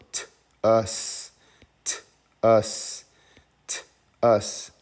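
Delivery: T-us with a gap after the t; phonics-style t... us, repeated; sounding out. Shown as a demonstration. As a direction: split T-us into a crisp t, then uss. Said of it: "Let's read this one".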